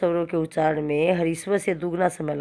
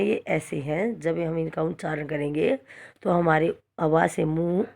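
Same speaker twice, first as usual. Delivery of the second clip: usual speaking voice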